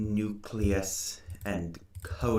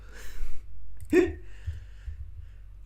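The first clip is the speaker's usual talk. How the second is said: high laugh. Real, not acted. Laughing at her own joke.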